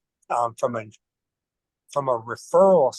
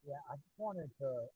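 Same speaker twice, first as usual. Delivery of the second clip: faint, distant mic; barely audible through a bad mic; very low volume; 3 semitones higher, 19 dB quieter than usual. Real, not acted. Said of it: "you're really low".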